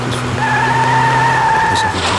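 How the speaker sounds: from a puzzled mutter to an alarmed near-shout; building alarm. Acted. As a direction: let it rise each time. Repeats, growing louder.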